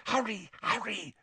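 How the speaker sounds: high, near-silent urging; mouthed urging. Acted. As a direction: barely voice it, urgently. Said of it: "she mouthed".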